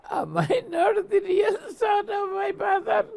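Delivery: high, laughing mock king; laughing the king's line; laughing through the quote. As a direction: voice the worried king while cracking up. King's doubt, told laughing.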